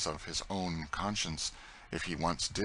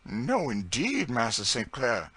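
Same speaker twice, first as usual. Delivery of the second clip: higher, slow, energetic protest; flash of energy; 4 semitones higher, 4 dB louder, slower than usual. Acted. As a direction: fire back with sudden energy. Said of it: "with a flash of energy".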